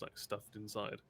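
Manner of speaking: usual speaking voice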